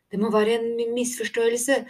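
high, anxious voice catching on a word; nervous stammer. A narrator's reading. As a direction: stumble over the word in alarm. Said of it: "stammet Tico". (engstelig, høy stamming)

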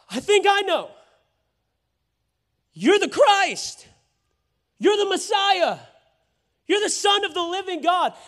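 high, loud eager confession; Peter excited. Acted. Peter blurting to Jesus, high and excited.

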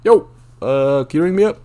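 politely asking the cashier to ring him up; polite request. Retold or imitated. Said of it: "as politely as I could".

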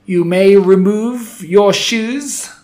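slow, high, loud harsh witch bark; rasping foreign-accented command; barked witch order. Acted. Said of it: "barked the Grand High Witch".

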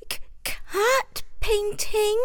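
high, slow, trembling; worried stammer. A narrator's reading. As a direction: stutter in fear. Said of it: "said a worried little voice".

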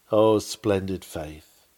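ordinary talk, nothing marked

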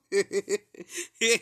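high, loud cackling laugh; imitated cackle. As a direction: keep cackling like her. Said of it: "she started laughing exactly like that too".

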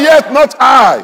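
very high, slow, loud declaration; rousing shout. Real, not acted. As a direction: stretch each word into a preacher's shout. Demands congregation repeat it better.

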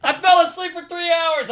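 shrieked high and loud in disbelief; incredulous shout. Retold or imitated. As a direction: yell it out, aghast at yourself. Repeats the outburst after laughter.

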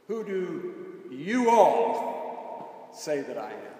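very slow, "you all" loud and drawn out; emphatic YOU ALL. Shown as a demonstration. Preacher showing the emphatic plural in Jesus' question, heavy stress on "you all". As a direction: set "you all" apart and swell on it. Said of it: "It's emphatic, and it is plural".

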